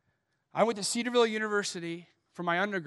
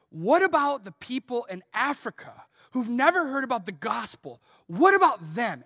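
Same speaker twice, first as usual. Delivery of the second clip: mock scream, pitch jumping on key words; retold angry yell; 4 semitones higher, 6 dB louder than usual. Angry student confronts the preacher in public, retold yelling. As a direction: yell the accusation, punching the opening words. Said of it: "he screams that at me".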